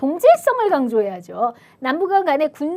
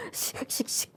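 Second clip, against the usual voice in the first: imitated seething puffs; angry huffing; 12 dB quieter, slower than usual. Shown as a demonstration. Teacher showing students how anger looks, angry huffing. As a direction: huff sharply as if fuming. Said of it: "막 이렇게 막 화가 나면".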